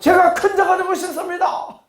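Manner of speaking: high shouted declaration to repeat; call and response shout. Shown as a demonstration. Pastor leading the congregation to repeat, shouted call. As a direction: belt it out for the crowd to echo. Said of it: "한번 따라합시다".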